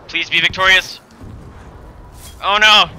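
loud, high pleading then dismay; anxious cheering. Real, not acted. Gamer pleading for his hero, then dismayed. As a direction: beg the fight to go your way.

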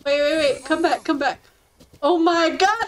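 ordinary talk, nothing marked

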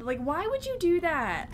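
protest, mildly annoyed at being startled; a little angry. Real, not acted. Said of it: "a little bit angry".

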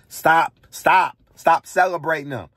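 frustrated loud demand to stop; loud command. Real, not acted. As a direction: hammer each stop. Ranting, demanding people stop.

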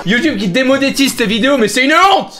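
ranting after too many shots. Real, not acted. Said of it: "Il est bourré".

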